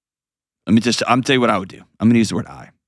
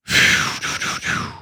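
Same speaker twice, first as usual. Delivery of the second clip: long unvoiced exhale; heavy sigh. Real, not acted. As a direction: blow out a long breath after an emotional speech. Exhales after an emotional appeal.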